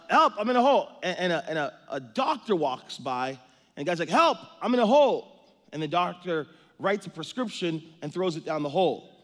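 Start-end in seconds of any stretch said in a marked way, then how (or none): 0.1-0.9 much higher and louder cry for help; calling for help. Acted. Man in hole yelling. 4.1-5.3 much higher and louder cry for help; calling for help. Acted. Man in hole yelling.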